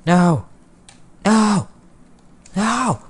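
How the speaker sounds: short, high cries of refusal, starting loud; high-pitched protest. Pretend. Starving player who can't eat raw wheat, high-pitched mock protest. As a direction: wail each one high like a mock tantrum.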